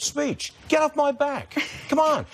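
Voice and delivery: high, indignant outburst; yelling. Real, not acted. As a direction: let the annoyance burst out high. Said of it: "That's how [name] yells".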